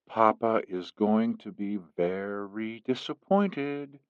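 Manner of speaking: low, slow and soft, almost a murmur; quiet veiled warning, drawn out; hushed and slow, letting the threat sink in. Acted. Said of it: "she said in a low voice".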